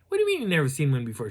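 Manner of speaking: fast, loud, high-pitched disbelief; incredulous. Retold or imitated. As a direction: fire back a baffled question. His incredulous reply, retold.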